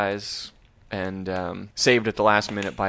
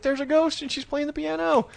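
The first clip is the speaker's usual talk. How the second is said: high-pitched boy's mock panic; alarmed protest. Boy telling his parents about the ghost, high mock panic. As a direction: insist in a high, worked-up voice.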